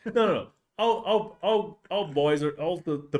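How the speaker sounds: high, loud playful protest; excited protest. Real, not acted. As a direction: insist it's not true, eagerly. Defending the angry dog.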